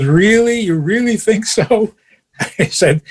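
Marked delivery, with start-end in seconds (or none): 0.0-1.9 high, lilting surprise; pleased disbelief. Retold or imitated. Wife asking husband about her new wig, pleasantly surprised. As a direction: imitate a flattered questioner, pitch raised.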